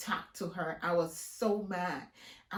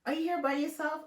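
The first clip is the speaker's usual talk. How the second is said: fake-friendly high greeting; 8 semitones higher, 4 dB quieter than usual. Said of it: "So I'm like".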